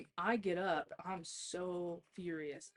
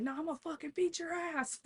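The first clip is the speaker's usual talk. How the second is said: furious threat; 10 semitones higher than usual. Retold or imitated. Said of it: "And I was like".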